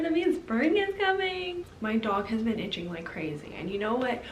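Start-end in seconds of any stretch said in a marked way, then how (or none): 0.0-1.7 much higher, bubbly delight at the birdsong; excited. Real, not acted. Vlogger hearing birds chirping, excited and much higher. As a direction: squeal it like spring is finally here. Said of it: "I'm so excited".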